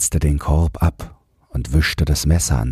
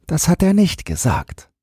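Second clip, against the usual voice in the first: high child's voice, a hint of weariness; weary little boy; 13 semitones higher than usual. A narrator's reading. Boy learns rats sleep at night, mildly weary. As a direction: answer as a worn-out small boy. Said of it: "sah mit einmal ganz müde aus".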